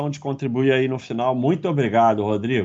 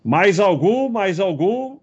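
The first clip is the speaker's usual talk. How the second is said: calling out; 7 semitones higher, 4 dB louder, slower than usual. Real, not acted. Calling out for more questions.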